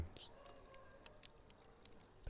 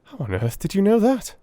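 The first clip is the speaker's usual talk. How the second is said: raised, startled question; astonished; 8 semitones higher than usual. A narrator's reading. Watson startled that Holmes guessed Afghanistan, astonished. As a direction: ask it in open disbelief. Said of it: "I asked in astonishment".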